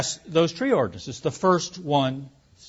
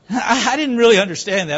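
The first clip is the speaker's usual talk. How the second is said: says it through a real laugh, higher and louder; 5 semitones higher, 4 dB louder than usual. Real, not acted. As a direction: still laughing in disbelief. Laughs after the absurd quote.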